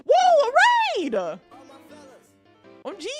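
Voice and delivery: high, loud raid squeal; excited shout. Real, not acted. Raid arrives.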